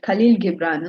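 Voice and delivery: ordinary delivery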